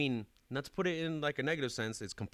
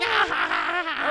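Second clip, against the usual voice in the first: high, loud cackle; maniacal laugh; 10 dB louder than usual. Retold or imitated. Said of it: "laughing in the corner maniacally".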